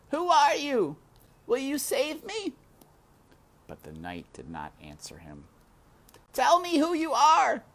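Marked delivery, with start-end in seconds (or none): 0.1-2.5 high, loud desperate call; shouting. A narrator's reading. Despero shouts to the silent knight, high and desperate. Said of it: "He shouted". 6.3-7.6 high, loud demanding shout. A narrator's reading. Despero shouts at the knight, loud and high. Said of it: "Despero shouted".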